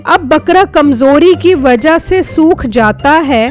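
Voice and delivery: plain reading voice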